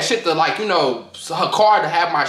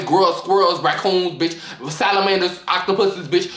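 ordinary talk, nothing marked

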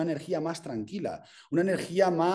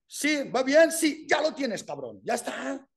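mock cheering; 9 semitones higher than usual.

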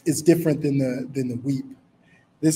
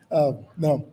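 ordinary delivery

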